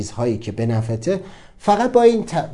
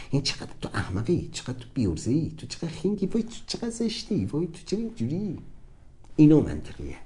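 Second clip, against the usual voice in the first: scornful inner voice; 8 dB quieter than usual. Retold or imitated. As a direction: belittle him quietly, full of disdain. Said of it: "من گفتم".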